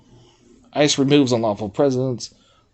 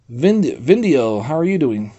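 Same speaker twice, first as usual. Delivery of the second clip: ordinary talk, nothing marked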